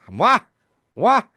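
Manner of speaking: acoustic test calls. Retold or imitated. Sheldon testing acoustics behind Leonard, loud and high. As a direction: call mwah right behind them. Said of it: "he goes".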